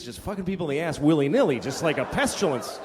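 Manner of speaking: ordinary talk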